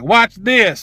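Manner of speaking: high-pitched dare; defiant challenge. Retold or imitated. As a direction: toss it out as a challenge.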